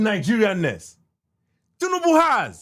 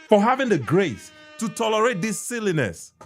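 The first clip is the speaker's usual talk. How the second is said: usual speaking voice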